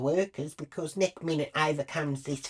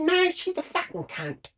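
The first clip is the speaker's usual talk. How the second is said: defiant retort. Retold or imitated. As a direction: refuse to back down. Arguing back at the ranger.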